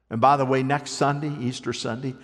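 ordinary talk, nothing marked

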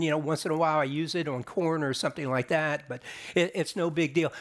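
ordinary delivery

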